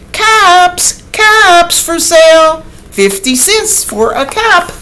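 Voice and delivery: high, loud street-vendor call; peddler calling out. Acted. Peddler crying his caps to the town. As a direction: call out your wares down the street. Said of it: "he called".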